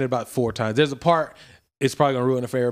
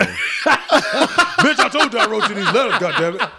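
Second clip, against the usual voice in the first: laughing mock boyfriend; 9 semitones higher, 5 dB louder, slower than usual. Acting the movie boyfriend, laughing.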